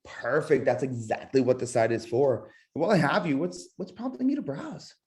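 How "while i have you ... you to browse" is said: curious rise. Shown as a demonstration. Agent probing a browsing buyer, high curious lilt. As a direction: lift it up, genuinely curious. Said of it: "the voice inflections throughout".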